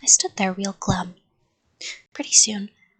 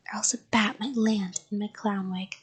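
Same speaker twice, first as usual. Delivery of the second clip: usual speaking voice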